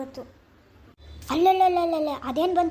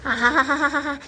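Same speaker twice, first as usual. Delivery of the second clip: a short scornful ha-ha-ha; mocking laugh; slower than usual. Husband laughs at his wife's money trouble, mocking laugh. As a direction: laugh at her misfortune.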